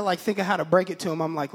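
usual speaking voice

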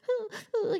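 put-on high little huffing noises of a nervous kid; imitated child's whimper. Mom imitates daughter going down the hallway, nervous huffing. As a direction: mimic a kid fretting before a shot. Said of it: "that stuff that kids do".

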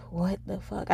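hushed, stunned "What the fuck?"; whispered disbelief. Retold or imitated. Retelling the crash to listeners, whispered disbelief. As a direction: breathe it out in shock, under your voice. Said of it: "but I was just like".

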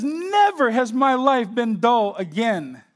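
high, loud and drawn out slowly; emphatic testimony. Retold or imitated. Preacher quoting a believer on his changed life, emphatically excited. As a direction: stretch it out with fervent conviction.

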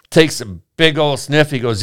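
usual speaking voice

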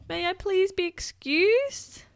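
high, slow, very polite; polite little girl. Acted. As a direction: ask sweetly and carefully.

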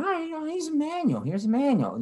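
very high sing-song introduction; squeaky saleswoman impression; mock saleswoman. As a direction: trill it like an overeager clerk. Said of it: "she's like".

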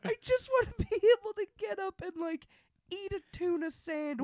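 high-pitched pleading whine; mock whining. Continues mock despair at diet.

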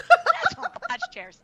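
laughing through the words, high and slow; cracking up at her own joke. Real, not acted. Host's guest laughing at her husband joke, laughing.

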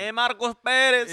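put-on loud, high, slow Spanish-accented name; exaggerated Spanish pronunciation, belted out. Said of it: "if I say it like that".

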